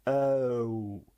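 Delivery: E sliding slowly down into O; gliding E-O sound. Shown as a demonstration. Teacher showing learners how two sounds join, gliding E-O. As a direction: glide from E to O as one sound. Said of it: "Vous entendez les deux sons".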